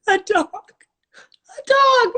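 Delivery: very high, loud, cracked-up cry; helpless high squeal. Real, not acted. Cracking up over getting a dog, high helpless squeal. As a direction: squeal it through real laughter.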